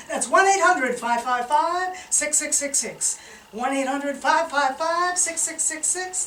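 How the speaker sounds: loud, high announcer reading the number; ad phone number. Acted. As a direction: rattle off the number like a commercial.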